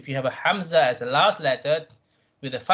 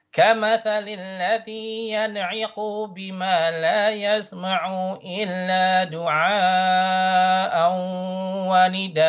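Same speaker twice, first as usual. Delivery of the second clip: higher, sustained chanting tone; Quran recitation; 4 semitones higher than usual. Shown as a demonstration. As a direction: recite with long madd vowels and humming nasals. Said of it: "the next line".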